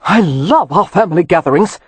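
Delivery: wry, amused understatement; dry sarcasm. A narrator's reading. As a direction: Leo joking dryly while pinning Kev. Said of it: "he heard Leo say".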